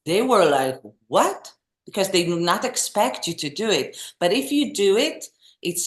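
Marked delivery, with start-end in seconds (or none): 1.1-1.5 high, rising what; mock astonishment, pitched up; surprised audience. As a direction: imitate a baffled crowd. Acts out the surprised Japanese audience.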